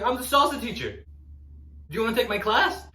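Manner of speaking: mock-proud salsa diva. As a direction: announce yourself like a star. Proud salsa teacher retort.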